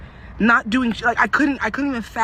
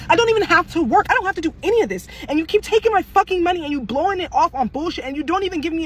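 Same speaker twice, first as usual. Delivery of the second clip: angry rant; 7 semitones higher, faster than usual. Real, not acted. Ranting at the government about her paycheck, angry and higher. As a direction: pitch jumps up in an angry rant.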